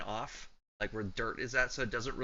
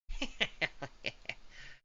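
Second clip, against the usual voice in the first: crackling cackle; 4 semitones higher, 5 dB quieter than usual. Acted. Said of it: "you hear a crackling voice".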